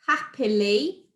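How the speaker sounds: slow "happily" in three beats, rising at the end; syllable by syllable. Shown as a demonstration. Teacher listing quiz choices, "happily" slowly segmented. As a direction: offer "happily" chunk by chunk as a quiz option. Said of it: "pronouncing the words clearly".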